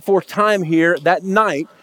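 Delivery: ordinary talk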